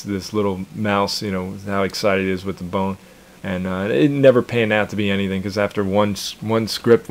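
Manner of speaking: ordinary talk, nothing marked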